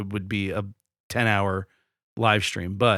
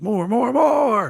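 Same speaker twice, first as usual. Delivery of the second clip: high, rising cries of more; greedy begging; 11 semitones higher, much slower than usual. Acted. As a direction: beg for more, climbing higher. Mock eager listener demanding more.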